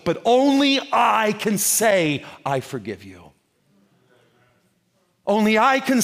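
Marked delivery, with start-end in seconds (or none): 2.5-3.2 tender. Retold or imitated. Jesus' words of forgiveness, dropped low.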